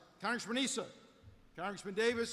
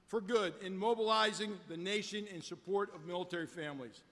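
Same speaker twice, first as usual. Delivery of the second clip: ordinary delivery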